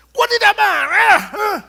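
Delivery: mock yell, high and loud; shouting denial. Red-faced man yelling he isn't angry, loud mock shout. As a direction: shout that you're not angry. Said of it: "Udah teriak".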